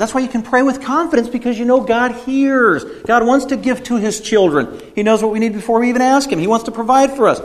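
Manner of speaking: high, fervent preaching voice; preaching at full force. Acted. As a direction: lift the sermon up, pleading with the flock. Preaching peak, raised voice.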